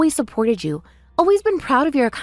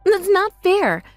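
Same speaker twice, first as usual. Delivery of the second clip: hurt protest; 9 semitones higher, much slower than usual. Acted. Sister protests insult.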